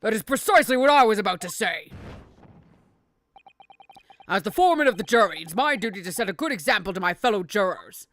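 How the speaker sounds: lightly voiced self-important foreman; jury foreman. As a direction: read as a dutiful British jury foreman.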